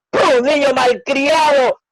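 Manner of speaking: high, loud furious scolding; mother scolding her son for the muddy feet; angry mother. Acted. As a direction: let the mother's built-up rage explode. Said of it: "ella le abofeteó enfadada".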